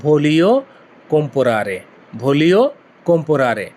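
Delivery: teacher drilling ভোলিও কম্পোরারে; Italian phrase voglio comprare. Shown as a demonstration. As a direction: model ভোলিও কম্পোরারে for students to repeat. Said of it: "কিনতে চাই এর Italian হচ্ছে".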